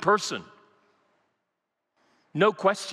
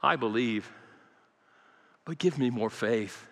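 quiet prayer; 7 semitones lower, 5 dB quieter than usual. Pretend. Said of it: "come to the Lord and say".

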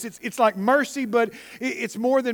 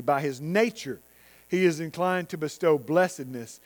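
softer, reverent reading of Tozer; quiet quoted reading; 5 semitones lower, 4 dB quieter than usual. Acted. Preacher quoting Tozer to the congregation, soft and reverent. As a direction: read the quote quietly, lower.

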